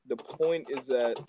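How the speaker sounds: ordinary talk, nothing marked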